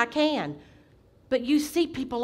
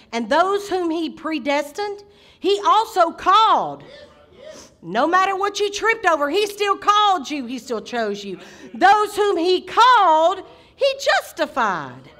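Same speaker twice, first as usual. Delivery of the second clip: raised pitch on rolling phrases; emphatic preaching; 6 semitones higher, 5 dB louder than usual. Real, not acted. Preacher assures congregation he still called you, emphatic preaching. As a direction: lift and stretch each "called". Preaches scripture at full force.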